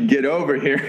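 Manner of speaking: laughing through the words; amused laugh while speaking. Real, not acted. Host laughs at story.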